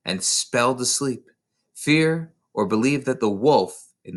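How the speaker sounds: lightly slowed poem reading, with one whispered line; a touch of slow romantic verse; slow recitation. Acted. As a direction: recite it slowly, letting the lines hang. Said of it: "in a rather wonderfully snow, uh, slow voice".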